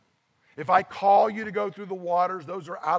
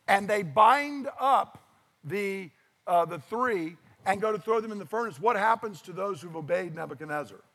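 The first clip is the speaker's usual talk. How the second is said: ordinary delivery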